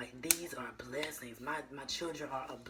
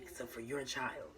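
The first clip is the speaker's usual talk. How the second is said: normal talking voice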